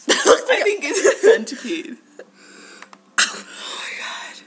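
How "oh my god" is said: whispered. Real, not acted. Whispered after bug.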